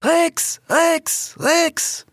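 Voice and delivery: high, punchy chant; crowd calling out. A narrator's reading. All the animals call for Rix, high chanting. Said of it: "riefen bald fast alle Tiere".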